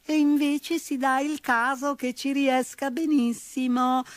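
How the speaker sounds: high peevish mouse squeak; petulant mouse. A narrator's reading. Mouse answering the bull again, petulant squeak. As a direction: retort again like a cheeky mouse. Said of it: "replicò ancora".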